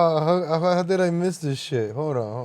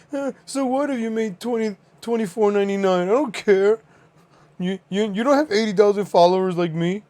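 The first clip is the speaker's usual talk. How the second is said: mocking voice; 8 semitones higher than usual. Mocking a rival's video.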